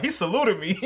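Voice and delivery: laughing through the words. Real, not acted. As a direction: crack up while telling it. Laughing while retelling.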